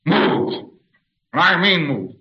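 acted anger, demanding obedience; angry command. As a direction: push MOVE out hard, as an acted order. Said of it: "Anger. He's demonstrating anger".

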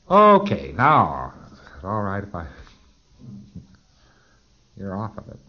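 usual speaking voice

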